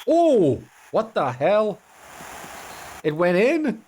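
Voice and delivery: high, loud shocked outburst; can't believe it went in; real astonishment, voice jumping up. Real, not acted. Shocked at unexpected goal.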